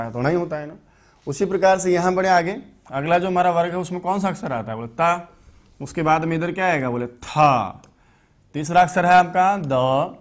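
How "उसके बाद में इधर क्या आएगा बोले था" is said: normal talking voice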